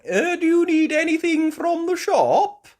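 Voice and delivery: high, loud call. Acted. Peppa's party asking neighbours about shopping, high calling voice. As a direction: holler the question high.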